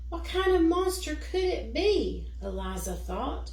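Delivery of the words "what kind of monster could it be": higher and louder, alarmed; frightened wonder. Acted. Eliza alone in the dark, alarmed at the shadow. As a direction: gasp out the question in alarm.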